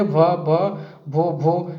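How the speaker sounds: stuttering भ, भ, भो, भो before the name; the girl tries her name and stammers; mock stammer. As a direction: catch on भो again and again. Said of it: "she began to stammer".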